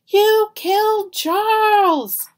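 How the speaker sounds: very loud, high, slow outraged yell. Acted. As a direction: scream it at the killer. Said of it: "Harry yelled".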